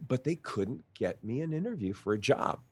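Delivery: usual speaking voice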